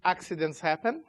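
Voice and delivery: normal talking voice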